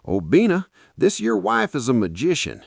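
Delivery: loud, high, delighted shout; shouted praise. Acted. Boss shouting praise at the host over dinner. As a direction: call it out with a full mouth of praise. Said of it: "Mister Johnson shouted".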